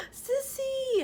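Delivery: high, bright call across the room; a little kid thrilled to spot her sister; excited call. Retold or imitated. Kindergartner calling to her big sister, excited high call.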